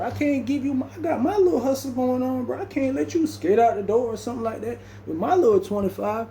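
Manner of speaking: hammed-up high voice, retelling himself; mock refusal. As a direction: pitch up to act out the refusal. Teller voicing his own refusal.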